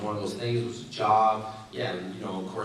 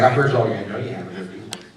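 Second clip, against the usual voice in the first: loud sleepy retort; 7 dB louder than usual. Retold or imitated. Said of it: "she's like".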